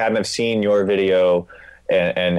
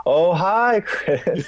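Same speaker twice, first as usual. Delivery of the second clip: put-on higher, strongly accented Wiseau voice; Tommy Wiseau impression; 10 semitones higher, much slower than usual. Podcast guest mimicking Tommy Wiseau's phone greeting, accented and high. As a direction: ham up Tommy's odd "oh hi" greeting. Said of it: "I just need a recording of him saying".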